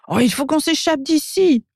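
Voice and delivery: high, urgent little elf; crying out. A narrator's reading. Elf urging his friend to escape, high-pitched cry. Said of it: "Mako s'écrie".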